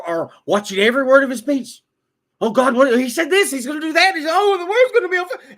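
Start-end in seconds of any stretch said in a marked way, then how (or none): 2.4-5.6 higher, louder mock hysteria with a laugh; mock panic. Mocking critics who fear a burning world, shrill and laughing. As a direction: ham up the terrified critic.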